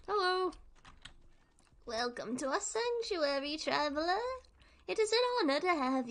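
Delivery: a hint of a cat. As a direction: sound as cat-like as possible. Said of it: "I try to sound as cat-like as possible".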